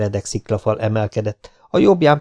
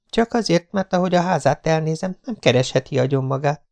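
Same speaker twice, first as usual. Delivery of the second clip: higher, lighter voice for the heroine, curious; young woman's voice; 7 semitones higher than usual. A narrator's reading. Jessica asks Spider about his friend, higher curious voice. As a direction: play the young woman, a little doubtful.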